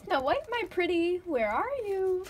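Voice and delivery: loud, high, sing-song crone call; witch calling. Acted. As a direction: lure her out like a wicked witch.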